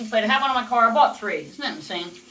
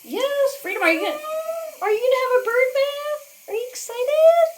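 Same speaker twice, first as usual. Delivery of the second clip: high pet talk; 4 dB louder than usual. Real, not acted. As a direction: coo up high to your parrot, bright and loud. Talking baby-talk to her parrot.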